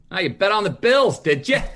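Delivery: mocking cabbie taunt. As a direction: tease them from the driver's seat, smug. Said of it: "this guy, and goes".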